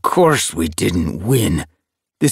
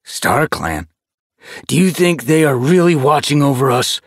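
higher, louder scornful doubt; disgusted tom; 7 semitones higher, 5 dB louder than usual. A narrator's reading. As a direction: sneer at the idea of StarClan. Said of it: "His whiskers twitched with disgust".

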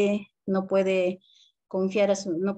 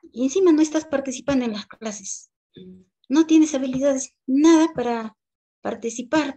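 scolding teacher; 5 semitones higher than usual. Retold or imitated. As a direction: tear into the boy over his homework. Teacher scolds boy for homework.

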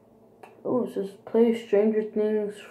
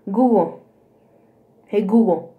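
repeating to voice assistant; 8 dB louder than usual. Real, not acted. Calling device again, louder.

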